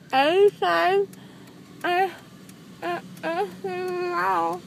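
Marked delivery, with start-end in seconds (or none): none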